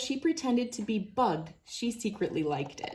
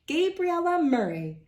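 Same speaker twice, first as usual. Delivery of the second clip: name called out high and loud; coach calling out; 8 semitones higher, 3 dB louder than usual. A narrator's reading. Coach calling roll to the team, called out. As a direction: call the name across the dressing room. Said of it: "the coach called out".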